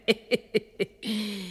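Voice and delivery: hushed witch cackle; soft evil cackle. Acted. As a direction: snicker to herself, pleased. Witch laughs again.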